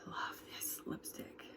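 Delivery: slow soft whisper. Real, not acted. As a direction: breathe it out slowly, fondly. Whispered love for product.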